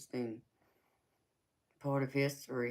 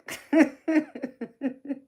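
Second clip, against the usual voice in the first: short high cackle of laughter; 13 semitones higher, 7 dB louder than usual. Real, not acted. Laughs at getting people drunk.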